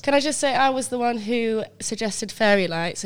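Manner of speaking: ordinary delivery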